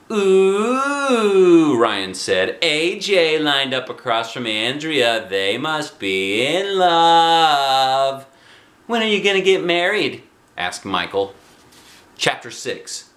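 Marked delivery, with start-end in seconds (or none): 0.1-1.8 very high, loud mock coo; teasing ooh. A narrator's reading. Ryan teasing A J about Andrea, high coo. 2.6-10.2 high, slow mocking drawl; sing-song teasing. A narrator's reading. Ryan and Michael teasing A J, sing-song. Boys teasing about love.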